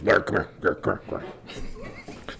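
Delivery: put-on half-whispered, beckoning drunk; mock drunk whisper. Drunk bartender calling a coworker over, laughing whisper. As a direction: pull him close and whisper like a drunk. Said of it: "Jack goes".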